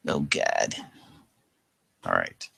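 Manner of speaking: ordinary delivery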